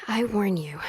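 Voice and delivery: hushed warning whisper. Acted. Wife whispering a teasing warning to her husband. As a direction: breathe the warning, no voice.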